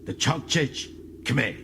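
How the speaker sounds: slow, deliberate alien words; slow proud Klingon naming his people; alien Klingon phrase. Acted. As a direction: lay out each syllable slowly and proudly.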